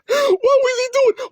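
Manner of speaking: high squeal of a question through laughter; cracking up while asking; laughing hard. Real, not acted. Laughing at the thought of [name] posing like a villain, squealing. Laughing at his own joke.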